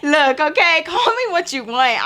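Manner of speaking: high, loud comic protest; mock-defensive retort. Daughter answers mom's chicken teasing, high defensive retort. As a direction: push back with pitch way up.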